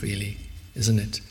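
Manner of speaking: normal talking voice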